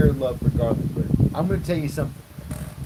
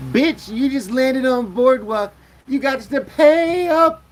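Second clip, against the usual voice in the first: high, slow sassy woman calling her out; mock sassy woman demanding rent; 10 semitones higher, 6 dB louder, slower than usual. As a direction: play the sassy friend demanding payment, slowly. Acted quote of a woman.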